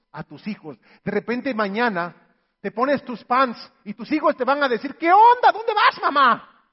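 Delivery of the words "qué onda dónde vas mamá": put-on high, loud teasing kid voice; hammed-up surprised child; mock kids teasing. Kids teasing mom heading out to exercise, high mock surprise.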